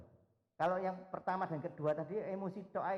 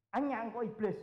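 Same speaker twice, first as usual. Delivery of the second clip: higher, louder sharp rebuke; mock rebuke; 6 semitones higher, 5 dB louder than usual. The Lord rebuking Peter, a sharp raised rebuke. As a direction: snap the rebuke at him. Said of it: "kakek ngomong".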